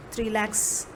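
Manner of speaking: ordinary talk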